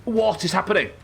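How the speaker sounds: high, loud panicked question; shocked by the sudden attack; alarmed disbelief. Real, not acted. Moose attacks in the game.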